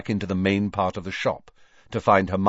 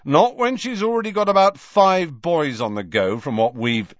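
louder, high-pitched brotherly disapproval; stern; 8 semitones higher, 5 dB louder than usual. A narrator's reading. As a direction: scold until cut off mid-sentence. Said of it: "becoming suddenly stern".

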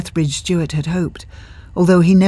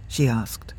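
plain reading voice

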